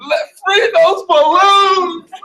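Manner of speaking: high, slow, loud crying plea; hammed-up sob for freedom; mock wail. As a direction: wail it like a tearful child.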